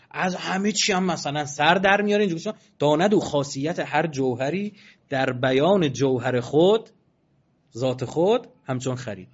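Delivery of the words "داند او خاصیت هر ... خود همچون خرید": slow, measured chanting of the verse; poem recital. Acted. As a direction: intone each line slowly like a Persian poet. Recites a mystic poem slowly.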